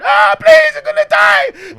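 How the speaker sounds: very high loud panicked scream; girl in the front seat screaming in fear; terrified scream. Retold or imitated. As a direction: shriek like she thinks she'll die. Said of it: "screaming, making up bare noise".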